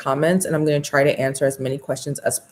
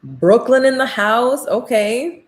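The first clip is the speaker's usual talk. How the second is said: hype shout-out; 6 semitones higher, slower than usual. Real, not acted. Hyping a viewer's city.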